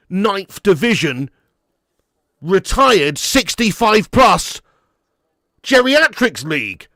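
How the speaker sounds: loud, high, exasperated rant. Real, not acted. Fan ranting to viewers about the striker's level, loud and exasperated.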